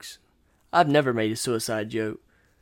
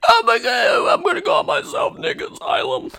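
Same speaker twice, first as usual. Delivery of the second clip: far higher, strained mock shout fading to a breathy rasp; crazed shriek; 5 dB louder than usual. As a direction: scream it like you're stroking out. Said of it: "hopefully I wasn't talking like that".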